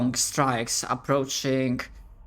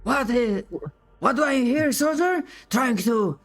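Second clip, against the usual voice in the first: climbing higher, barked challenge; drill colonel; 7 semitones higher than usual. Acted. Colonel rounding on a soldier who spoke up, rising barked challenge. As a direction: snap back at the cheeky soldier.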